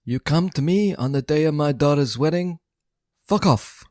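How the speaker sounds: bad Brando godfather voice; Don Corleone impression. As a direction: play the Godfather, menacing then blunt. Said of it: "Have you heard my impression?".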